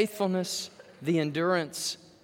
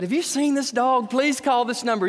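raised, louder plea for a lost dog; pleading flyer voice; 6 semitones higher, 4 dB louder than usual. Retold or imitated. Preacher voicing his brother's lost-dog flyer, raised and pleading. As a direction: call out the flyer like a desperate owner.

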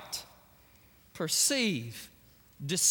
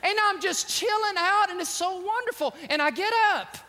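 excited storytelling; 7 semitones higher, 4 dB louder than usual. Real, not acted. Excited nap story, loud and high.

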